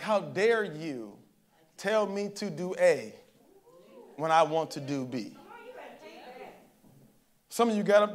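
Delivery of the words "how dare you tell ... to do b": slow, deliberate defiance, word by word; defiant. Acted. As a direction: challenge God slowly, as if shaking a fist. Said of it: "almost shaking your fist at God".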